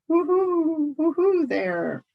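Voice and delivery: high hooting owl voice, slow on the second hoot; demanding owl hoot. Acted. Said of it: "demanded a voice from the tree".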